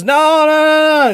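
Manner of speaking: high, slow, put-on little girl begging; little girl pleading. Retelling the film's girl over her fallen mother, high slow plea. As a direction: plead slowly like a terrified small girl. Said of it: "and then the little girl's".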